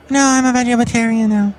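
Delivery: Kelly Stewart voice. Acted. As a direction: pipe it up high like a cheery chef supervisor. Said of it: "It's Kelly Stewart".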